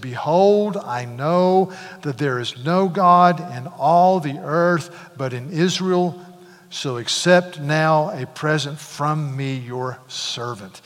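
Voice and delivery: slow, declaring Behold; solemn proclamation. Acted. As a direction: proclaim it slowly, a healed man's vow. Reads Naaman's declaration.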